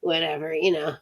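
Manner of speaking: normal talking voice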